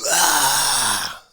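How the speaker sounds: high, loud put-on yell; a hammed-up angry scream; mock heckler scream. Heckler screaming at him, hammed-up scream.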